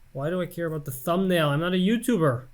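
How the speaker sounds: normal talking voice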